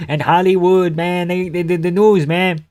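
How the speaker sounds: lightly voiced French-Canadian rant; GSP impression. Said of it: "Impression of anti-Semitic G S P".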